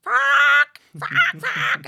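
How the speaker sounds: loud, very high imitated screeches; seagull-like squawk. Mimicking brawlers outside like seagulls, screeched. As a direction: squawk each curse like a gull. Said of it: "flock of seagulls".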